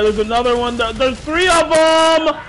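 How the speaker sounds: panicked alarm. Real, not acted. Enemies swarming in game.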